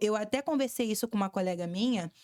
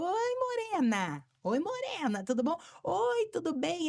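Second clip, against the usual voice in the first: mock sugary greeting; 7 semitones higher than usual. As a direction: mimic coworkers cooing a hello. Coworkers greeting her at reception. (imitação de cumprimento agudo e arrastado)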